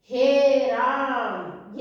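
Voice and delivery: हे राम with the vowel in राम stretched very slow, x0.3; हे राम as a prolonged pluta call; drawn-out calling. Shown as a demonstration. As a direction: call out हे राम, holding the आ of राम long. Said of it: "काफी ऊंचे स्वर में बोला जाता है, तीन गुना समय".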